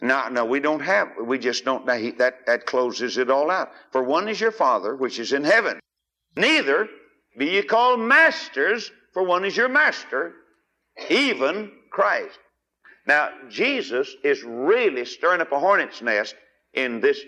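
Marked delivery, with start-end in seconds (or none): 3.9-12.4 preaching scripture reading. Acted. Preacher reads scripture to congregation, fervent. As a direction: read it out to the congregation with fire. Reads verses with rising pitch.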